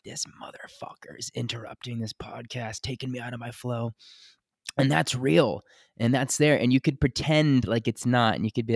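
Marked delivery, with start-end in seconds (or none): 0.0-3.9 hissed under the breath, a seething whisper; whispered mock rage. Imitating his cohost's silent rage at the leaf blower, in a seething whisper. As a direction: whisper it like bottled-up fury you can't let out. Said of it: "that deep rage of".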